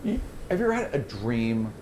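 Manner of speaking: slow, hushed vision narration; game master's scene setting. Acted. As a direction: draw them into the eerie vision slowly. GM describes the vision.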